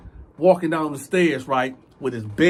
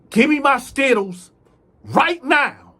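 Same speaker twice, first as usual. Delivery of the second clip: loud, higher command; angry order; 9 semitones higher, 6 dB louder than usual. Retold or imitated. Inmate ordering the thief, loud and angry. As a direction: bark it at him, no arguing.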